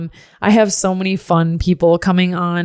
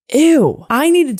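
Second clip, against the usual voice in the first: high, louder grossed-out ew; disgusted ew; 8 semitones higher, 4 dB louder, slower than usual. Real, not acted. To listeners, grossed out by her past self, loud. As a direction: recoil at the idea.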